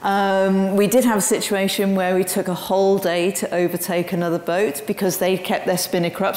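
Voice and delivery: normal talking voice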